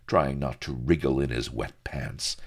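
ordinary delivery, nothing marked

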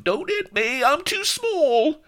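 extremely high, loud cartoon shriek; tiny coward's piercing wail; terrified squeal. A narrator's reading. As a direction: squeal for mercy, stretching the last words. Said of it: "with a squeal of".

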